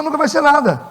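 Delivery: ordinary talk, nothing marked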